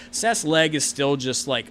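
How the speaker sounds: ordinary delivery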